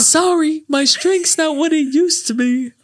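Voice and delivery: movie character quote. Retold or imitated. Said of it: "He's all".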